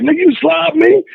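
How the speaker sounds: retold heated outburst; incredulous angry retort. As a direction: fire it back in disbelief.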